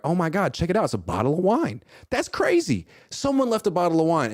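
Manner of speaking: high, hammed-up fake astonishment; mock surprise. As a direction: play it like you're stunned to find it. Acting fake surprise on a date.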